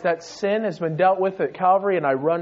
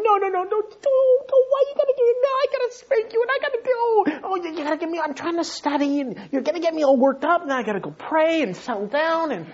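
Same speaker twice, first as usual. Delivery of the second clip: much higher, fretting rant; exasperated father; 8 semitones higher, faster than usual. Retold or imitated. Father fretting about spanking Isaac, high exasperated rant. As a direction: whine it out, half laughing. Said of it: "In my mind, I'm just going".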